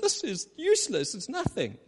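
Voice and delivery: lightly voiced weeping elders. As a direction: whine it like mourning elders. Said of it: "And they go".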